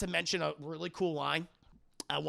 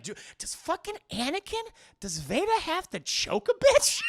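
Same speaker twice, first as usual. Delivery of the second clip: high threatening voice; 11 semitones higher, 5 dB louder than usual. Retold or imitated. Anakin threatening, acted.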